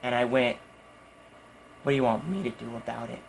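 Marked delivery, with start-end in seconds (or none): 1.8-3.2 low, soft, quick comeback; understated, lowered acted challenge; deadpan retort. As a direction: say it quietly, staring him down. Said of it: "I look him dead in the eye".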